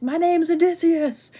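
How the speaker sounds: high, loud, drawn-out call; mock proud shout. Odysseus taunting the Cyclops as they leave, hammed-up shout. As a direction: shout it slowly as if across a distance. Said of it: "he shouts to the Cyclops".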